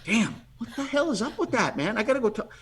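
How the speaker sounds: annoyed inner thought. Retold or imitated. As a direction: grumble to yourself in disbelief.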